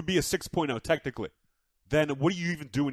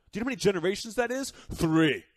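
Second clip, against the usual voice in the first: usual speaking voice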